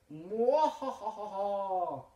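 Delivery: high slow villain cackle; drawn-out wicked cartoon cackle; evil laugh.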